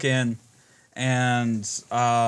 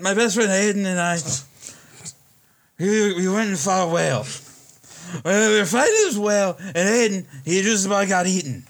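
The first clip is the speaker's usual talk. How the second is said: put-on drunk slurring; 5 semitones higher than usual. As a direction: slur it like a hammered bar drunk. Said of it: "he's slurring his words".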